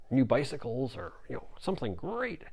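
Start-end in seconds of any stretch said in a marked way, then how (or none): none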